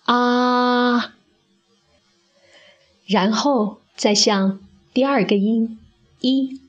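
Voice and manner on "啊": 啊 held with the mouth wide, like at the doctor; teacher's doctor-check ah; wide open ah. Shown as a demonstration. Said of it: "好像有医生在检查我们的口腔一样".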